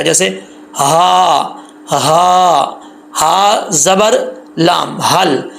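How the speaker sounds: slow syllable-by-syllable हा ज़बर लाम; heavy chest voice. Shown as a demonstration. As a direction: spell out हल from the chest. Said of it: "भारी आवाज़ के साथ पढ़ाएगा।".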